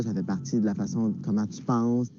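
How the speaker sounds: ordinary talk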